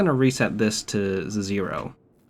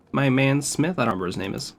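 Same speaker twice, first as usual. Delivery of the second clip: ordinary talk, nothing marked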